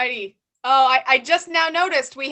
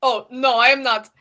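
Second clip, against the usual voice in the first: lightly put-on Russian accent; 3 dB louder than usual. Party guest [name] denies being a Russian spy, in a light Russian accent. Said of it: "stereotype based on your accent".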